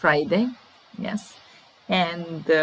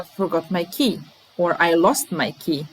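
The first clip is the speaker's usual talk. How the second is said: normal talking voice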